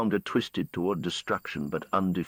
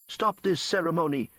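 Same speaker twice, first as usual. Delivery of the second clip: sharp, much higher commanding cry; a lightly voiced hero's demand; 11 semitones higher than usual. A narrator's reading. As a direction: order them to halt the ritual. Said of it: "Elias demands".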